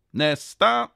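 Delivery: high, loud summons with a rising end; called out. A narrator's reading. Kontrollanten ropar till kön, called out. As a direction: call the next one up. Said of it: "någon som ropade".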